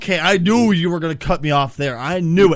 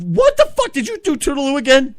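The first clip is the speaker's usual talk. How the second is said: much higher, louder incredulous cry; exasperated high outburst; 6 semitones higher, 4 dB louder than usual. Real, not acted. Boss scolds co-host for the catchphrase, high incredulous yelp. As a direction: yelp in disbelief at the interruption.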